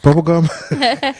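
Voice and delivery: slow, high teasing drawl; sing-song mockery. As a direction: stretch it out mockingly. Mocking K-pop sound.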